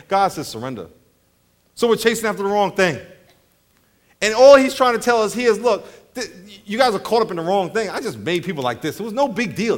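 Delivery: usual speaking voice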